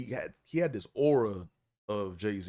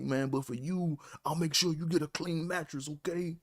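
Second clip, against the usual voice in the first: Raheem's eager promise; 6 semitones higher than usual. Retold or imitated. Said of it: "he's like".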